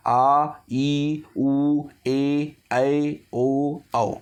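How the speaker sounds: आ, ई, उ, ए, ऐ, ओ, औ each held long; long vowels. Shown as a demonstration. Teacher reciting long vowels for students, आ to औ each drawn out. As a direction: draw out each of आ, ई, उ, ए, ऐ, ओ, औ separately. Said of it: "दुगना समय लगता".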